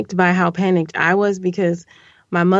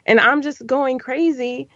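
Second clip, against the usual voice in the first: normal talking voice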